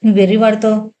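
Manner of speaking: plain reading voice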